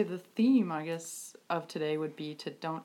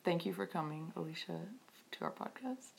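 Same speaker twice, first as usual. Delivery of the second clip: softer and softer into a whisper; fading to whisper; whispered translation; 10 dB quieter than usual. Acted. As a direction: let it fade to a whisper. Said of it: "you would be saying".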